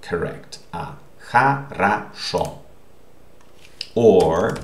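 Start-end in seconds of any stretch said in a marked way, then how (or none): 1.3-2.6 хорошо broken into syllables, "ha-ra-SHO"; teacher's syllable-by-syllable хорошо, stress on the last; unstressed O as A. Shown as a demonstration. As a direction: say хорошо with the first two O's as A. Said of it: "so these two are pronounced".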